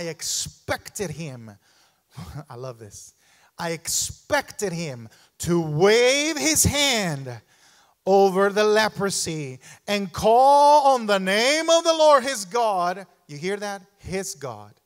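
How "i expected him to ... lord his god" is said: slow, loud, grand demand; haughty expectation. Retold or imitated. Preacher voicing proud Naaman, slow and loud. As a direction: puff up like a proud warrior. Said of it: "He said, look at this".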